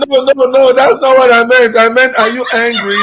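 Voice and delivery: high, laughing denial; laughing protest. Retold or imitated. Wife correcting herself to mother-in-law, laughing. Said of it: "my wife was like".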